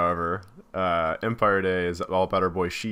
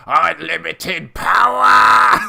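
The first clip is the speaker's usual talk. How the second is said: slow, loud, higher evil cry; Palpatine shout; 8 semitones higher, 12 dB louder, much slower than usual. Acted. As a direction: roar it like the Emperor.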